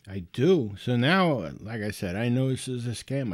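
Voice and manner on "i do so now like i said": usual speaking voice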